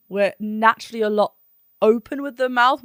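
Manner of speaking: usual speaking voice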